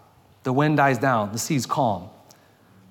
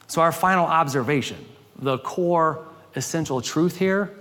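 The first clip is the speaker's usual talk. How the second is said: ordinary talk, nothing marked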